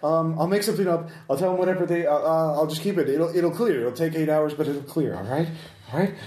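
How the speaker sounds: high, anxious voice making promises; placating his captors, eager to please; nervous businessman. Acted. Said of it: "He will say".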